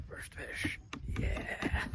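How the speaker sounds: hushed, whispered little celebration; whispered cheer. Real, not acted. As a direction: whisper it, drawing out the yeah. Celebrating the first catch quietly.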